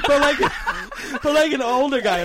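laughing through words. Real, not acted. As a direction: giggle while you talk. Laughing while describing accent.

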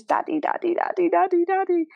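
high, rising mock wail for daddy; imitating child screaming. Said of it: "he just screams for daddy".

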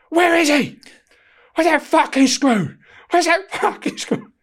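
high, loud, slow bellow down the corridor; mock furious yell through the changing rooms; angry calling. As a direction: call out hunting for the officer. Prisoner storming down, calling out.

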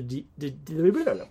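tongue-tied, repeating the first syllable; real tongue-tied stutter. Real, not acted. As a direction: stumble on the syllable until it turns to babble. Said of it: "sorry, I'm stuttering here".

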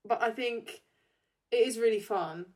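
usual speaking voice

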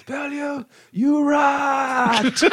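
high, strained comic accusing cry; mock outrage. Imitates angry man accusing friend.

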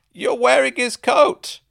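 high, loud, drawn-out outburst; laughing blurt. A narrator's reading. Woman pointing at the narrator's borrowed coat, blurting. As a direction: blurt it out at full pitch. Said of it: "she blurted".

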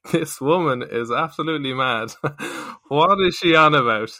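laughing disbelief. Retold or imitated. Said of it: "I remember thinking".

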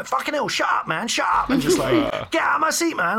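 high, mocking yobbish shouting; rowdy teens barging into the cinema, imitated high; rowdy teenagers. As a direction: lampoon loud teenage lads bickering. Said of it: "they all just came in just like".

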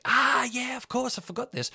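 high, sudden remembering; mock realisation. Answering Chris's reminder, mock surprised realisation. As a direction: act out remembering the trick. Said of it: "I was like".

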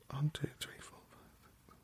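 whispered count to yourself; very soft, tallying in a whisper; counting under the breath. Real, not acted. Checking a word's letter count, counting in a whisper.

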